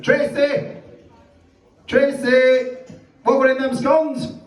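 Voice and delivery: high, carrying call for Tracy; calling through to the back. Retold or imitated. Bakery woman calling to Tracy in the back, high and carrying. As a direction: call out across the shop, pitched high. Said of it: "She went".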